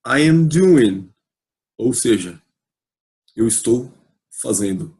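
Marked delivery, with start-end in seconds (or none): 0.1-1.1 I am doing spoken very slowly and carefully; slow model English. Shown as a demonstration. Teacher reading the lesson title to students, very slow. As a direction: spell out I am doing slowly for learners. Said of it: "o título dessa lição é".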